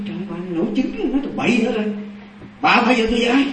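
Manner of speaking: high, exasperated retold scolding; incredulous scolding. Husband tells wife she is talking nonsense, incredulous scolding. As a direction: dismiss her nonsense with pitch rising.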